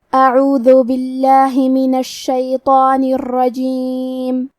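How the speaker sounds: slow melodic tajweed chant; Quran recitation. Acted. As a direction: recite it slowly, drawing out vowels. (قرآن کی دھیمی تلاوت)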